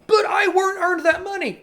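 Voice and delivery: high-pitched mocking complainer; exaggerated, high indignant objection; mock protest. Acts protest of naive taxpayer.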